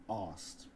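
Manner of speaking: "asked" as Cockney "arst", long vowel, no K. Shown as a demonstration. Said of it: "Can you stop saying".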